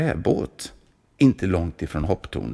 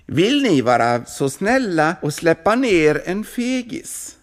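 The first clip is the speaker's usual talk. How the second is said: raised voice, mocking; 10 semitones higher, 3 dB louder than usual. A narrator's reading. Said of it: "höjer Rödskägg sin stämma".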